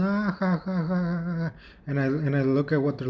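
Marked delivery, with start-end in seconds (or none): 0.0-1.5 high mock laugh, "ah-ha-ha-ha"; imitated crowd laughter. Telling how the living room burst out, mock laughing. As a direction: imitate a roomful of people laughing. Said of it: "they start laughing".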